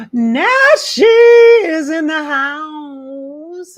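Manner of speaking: slow, loud, drawn-out welcome shout; excited announcement. Real, not acted. Medium welcoming a guest with a big slow shout. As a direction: call it out like an MC.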